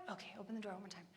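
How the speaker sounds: low and hushed, resigned; weary bedtime murmur. Retold or imitated. Mother giving in to son at bedtime, low weary murmur. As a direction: give in under your breath.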